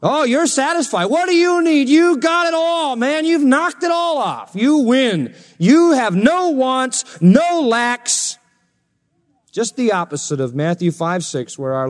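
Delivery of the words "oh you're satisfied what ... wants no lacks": very high, drawn-out mock amazement; sarcastic. Paul to the self-satisfied Corinthians, sarcastic. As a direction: pile on fake congratulations, pitched way up. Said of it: "he starts to use sarcasm".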